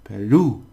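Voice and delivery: Peru said loud with the last syllable stressed; final stress. Shown as a demonstration. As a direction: lean on the ru of Peru. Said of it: "Peru, because it's got a, an accent".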